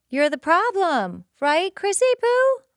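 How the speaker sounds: high, loud sing-song mockery; mocking taunt. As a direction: taunt her like a bully. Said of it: "he mocks her".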